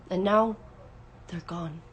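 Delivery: halting, fading almost to nothing; soft, desolate drop after a pause; grieving. A narrator's reading. Parent mourning the missing children, grieving quietly.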